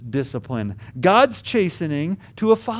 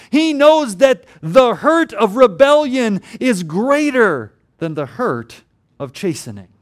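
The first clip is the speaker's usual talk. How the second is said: preaching with force; 5 semitones higher, 6 dB louder than usual. Acted.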